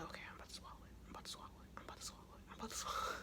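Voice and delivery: very soft, voiceless muttered repetition; whispered self pep talk. Retold or imitated. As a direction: psych yourself up under your breath. Acts out psyching self up for pill.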